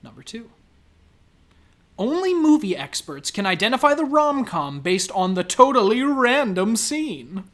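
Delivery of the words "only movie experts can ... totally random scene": loud, swooping quiz-title read; headline read. Acted. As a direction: sell the clickbait, stretching the final words.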